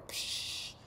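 usual speaking voice

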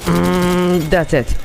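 ordinary talk, nothing marked

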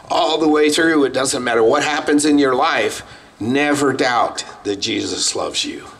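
preacher pressing the congregation with rising fervour; fervent preaching. Acted. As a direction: drive the point home to the congregation. Preacher building to an appeal.

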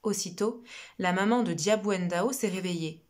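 usual speaking voice

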